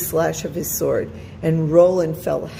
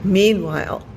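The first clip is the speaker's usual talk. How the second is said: ordinary delivery, nothing marked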